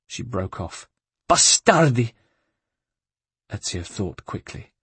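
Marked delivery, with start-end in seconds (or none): 1.3-2.1 loud spat-out Italian curse; angry curse. Acted. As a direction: curse them with fury. Curse at the guards.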